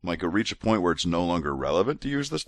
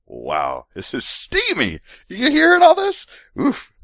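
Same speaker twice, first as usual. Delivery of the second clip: usual speaking voice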